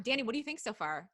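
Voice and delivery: ordinary delivery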